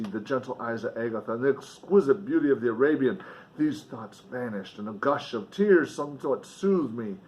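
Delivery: usual speaking voice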